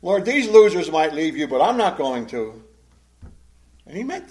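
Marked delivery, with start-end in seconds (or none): 0.0-2.6 loud, dismissive of the others; proud boast. Acted. Peter telling his Lord he outdoes the others, prideful. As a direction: boast loudly of your own loyalty. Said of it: "hear the hint of pride in that?".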